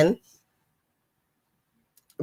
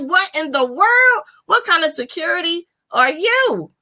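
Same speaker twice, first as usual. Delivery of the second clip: high-pitched mock exasperation; higher, exaggerated bafflement; retold disbelief; 9 semitones higher than usual. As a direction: act out incredulous scolding. Acts out her disbelief at character.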